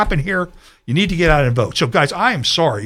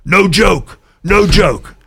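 light mock of an old president, higher and louder; a hint of mocking Joe Biden; 5 semitones higher, 5 dB louder, much slower than usual. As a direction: poke fun with a quick, breezy take-off. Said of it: "as Joe Biden would say".

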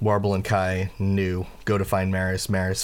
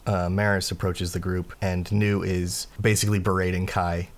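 ordinary delivery

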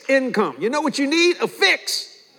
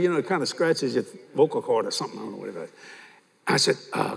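normal talking voice

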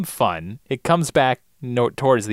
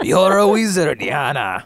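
louder start, dropping off at the name; echoed Hagrid line; 2 semitones higher, 3 dB louder, slower than usual. Acted. Co-host echoes the Hagrid joke, fading mock voice.

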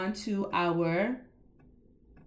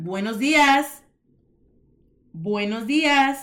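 "buenos dias" slow and louder; slow model; 3 semitones higher, 6 dB louder than usual. Shown as a demonstration. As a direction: draw out "buenos dias" for the class.

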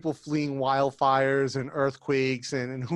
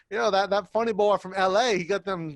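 put-on Texas accent; 5 semitones higher than usual. Local guy sizing up the L A newcomer, Texas accent. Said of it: "you drop into that, like, Texas accent".

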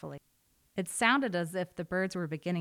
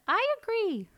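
little wren; 6 dB louder than usual. A narrator's reading. Wren agrees, surprising birds.

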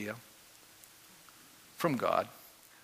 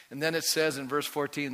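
ordinary talk, nothing marked

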